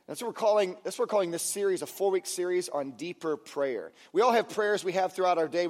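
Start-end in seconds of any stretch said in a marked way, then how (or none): none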